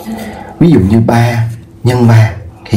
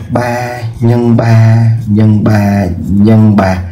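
slow dictation; much slower than usual. Shown as a demonstration. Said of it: "teacher dictating multiplication".

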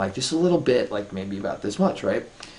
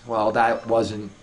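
ordinary delivery

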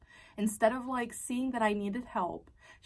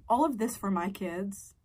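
ordinary talk, nothing marked

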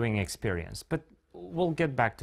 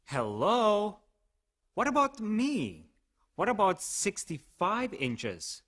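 whiny pleading voice; 9 semitones higher, slower than usual. Acted. TV-size voice begging for attention.

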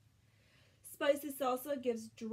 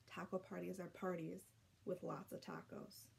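whispered, much softer; whispered aside; 5 semitones lower, 11 dB quieter than usual. A narrator's reading. Reader whispering a secret aside to the children. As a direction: lean in and whisper it.

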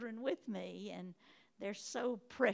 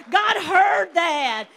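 triumphant shout; 9 semitones higher, 23 dB louder, much slower than usual. Acted.

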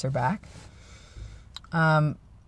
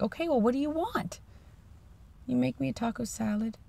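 high, soft and tender; gentle; 7 semitones higher, 8 dB quieter than usual. Retold or imitated. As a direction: ask softly like to a patient. Gently asking sore wife.

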